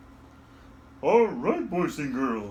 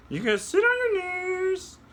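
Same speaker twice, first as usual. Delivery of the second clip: high puppet voice. Acted. Puppet voice instructs.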